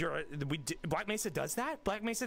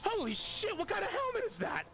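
high-pitched, startled disbelief; shocked. Acted. Player reacts to a strange helmet, shocked. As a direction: blurt it out in shock.

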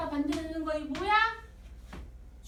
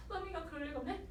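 disbelieving suspect; 2 semitones higher, 11 dB quieter, faster than usual. Retold or imitated. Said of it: "이러면서".

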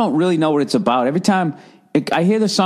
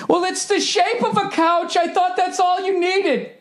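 much higher mocking voice; mock dumb voice. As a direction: play a clueless designer, high and silly. Mocking couch maker's logic.

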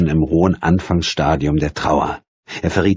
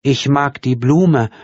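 put-on slow, higher, simple child voice; childlike voice; 9 semitones higher, 4 dB louder, slower than usual. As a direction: say it slowly like a child. Childlike daughter.

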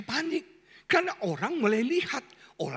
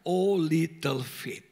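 slow English quote; 7 semitones lower, much slower than usual. Acted. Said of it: "Dalam bahasa Inggrisnya".

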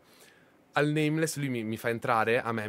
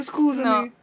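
crying out; 11 semitones higher, 9 dB louder, much slower than usual. Real, not acted. Apologizing after hurting her.

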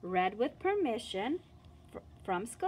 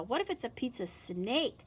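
ordinary delivery

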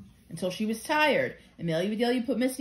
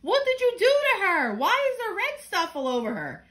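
alarmed outburst; 9 semitones higher, 3 dB louder than usual. Acted. Mother finds baby covered red.

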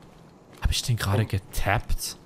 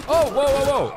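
high, loud surprised wows; startled; 6 dB louder than usual. Real, not acted. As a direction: caught off guard by a shot. Sudden fight surprise.

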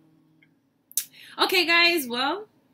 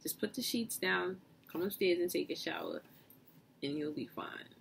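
soothing grandma; 4 semitones lower, 10 dB quieter than usual. Retold or imitated.

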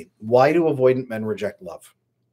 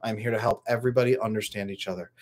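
usual speaking voice

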